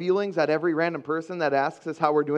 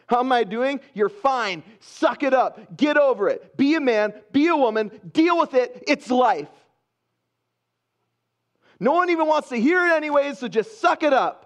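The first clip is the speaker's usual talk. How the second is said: loud, higher-pitched tough-love orders; harsh self-scolding; 6 semitones higher, 5 dB louder than usual. Acted. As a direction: order yourself to toughen up, forcefully. Acted harsh self-talk, scolding himself.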